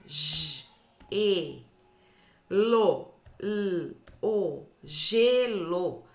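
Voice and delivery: letters and "gelo" spelled out slowly; spelling aloud. Shown as a demonstration. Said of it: "Tão vendo a diferença".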